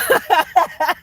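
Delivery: a high, loud burst of laughter; real loud laughing fit. Real, not acted. Laughing at everyone crying.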